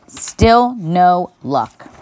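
ordinary delivery

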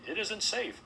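high-pitched urgent warning; exclaiming. A narrator's reading. Host warning guests against leaving, high exclamation. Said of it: "exclaimed Mister Harwood".

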